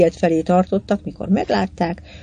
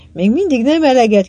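higher-pitched call back, only mildly raised; shouting back; 6 semitones higher, 4 dB louder than usual. A narrator's reading. John answering the rival captain across the water, calling back. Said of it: "kiáltott vissza John".

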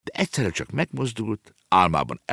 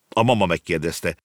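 usual speaking voice

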